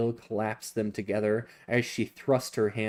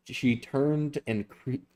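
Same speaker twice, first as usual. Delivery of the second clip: ordinary delivery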